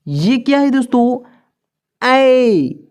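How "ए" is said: ordinary talk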